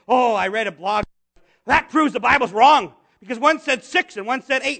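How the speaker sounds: loud, hammed-up know-it-all claim; mock triumphant debunker; mocked blog reader. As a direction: parody someone crowing over a discovery. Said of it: "as some people say".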